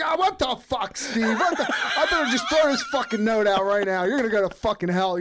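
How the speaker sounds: high, loud, hammed-up outrage; exasperated mom rant, played for laughs; mock angry mom. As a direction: imitate a fed-up mother blowing up. Acts out outraged mother scolding him.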